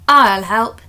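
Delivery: put-on a keen child shouting to help; eager call. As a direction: call out from the back row. Freya eagerly calls out.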